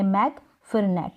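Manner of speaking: "मैग" then "नेट" as two parts; syllable split. Shown as a demonstration. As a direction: keep the g on "मैग", start "नेट" separately. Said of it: "अलग-अलग syllable में आ रहा है".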